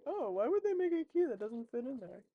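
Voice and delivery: high, loud and indignant, in a put-on voice; mock baffled outrage. Retelling her own confusion to viewers, in loud, high mock indignation. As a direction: ham up the confusion over the key that won't fit. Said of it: "I was like".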